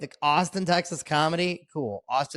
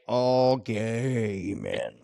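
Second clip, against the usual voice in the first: very slow, stretched out, lower; drawn-out punchline; 5 semitones lower, much slower than usual. Acted. Comedian telling the podcast host about the bathhouse, drawn out slowly. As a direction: drag each word out for the punchline.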